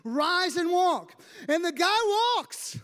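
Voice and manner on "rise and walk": slow, loud command to rise. Acted. Peter commanding the lame man, loud and slow. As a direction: proclaim it like a healing command.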